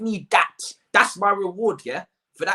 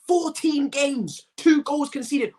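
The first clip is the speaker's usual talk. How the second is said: incredulous stress; 8 semitones higher, slower than usual. Real, not acted. Ranting fan stressing stats.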